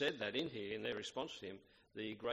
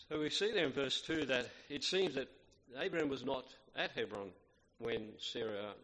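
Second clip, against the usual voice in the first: ordinary talk, nothing marked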